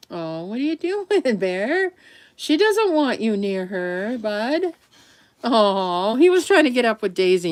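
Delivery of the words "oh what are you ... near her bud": dog talk. Real, not acted. Talking to her dog.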